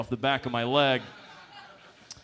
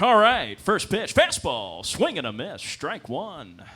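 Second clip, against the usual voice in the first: play-by-play call, loud at the start; mock radio announcer calling pitches; baseball commentator; 5 semitones higher, 6 dB louder, slower than usual. Struggling commentator calling a baseball game, punchy play-by-play voice. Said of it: "give it up for the commentators".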